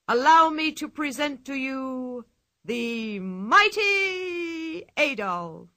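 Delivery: booming showman announcement; circus ringmaster. Acted. Ringmaster presenting the mighty Adolf to the crowd, booming. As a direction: sell the act like a barker.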